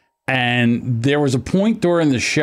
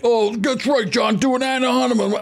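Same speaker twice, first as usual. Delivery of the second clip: high-pitched mocking quote of a scolder; mocking imitation; 10 semitones higher than usual. Retelling a friend's accusation in the Discord, high mocking imitation. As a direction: imitate him in a much higher voice. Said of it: "Andrew comes in and he starts saying".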